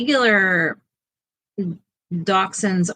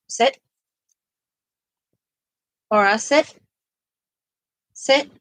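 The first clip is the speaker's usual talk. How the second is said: firm dog command; 4 semitones higher than usual. Real, not acted.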